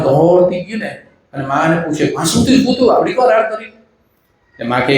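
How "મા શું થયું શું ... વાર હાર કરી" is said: high-pitched anxious questions; worried asking. Retold or imitated. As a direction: rush in alarmed, asking what happened. Daughter-in-law runs to worried mother.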